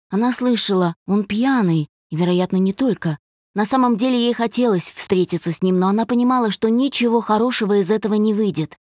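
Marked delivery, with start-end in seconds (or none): none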